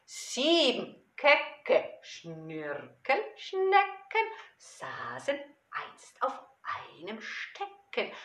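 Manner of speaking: playful, slow, drawn-out verse with big swings; children's poem recitation. Acted. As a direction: recite it theatrically for small children.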